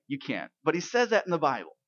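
usual speaking voice

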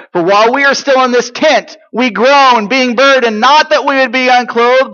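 high, driving pulpit delivery; forceful preaching. Real, not acted. Preacher reading and applying Paul to the congregation, high and emphatic. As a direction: build the contrast with conviction.

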